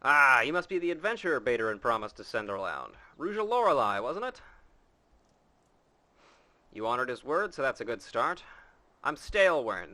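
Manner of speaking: put-on loud, gruff old sea dog; old pirate voice. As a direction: boom it out like a retired pirate captain. Voices old pirate orchard keeper.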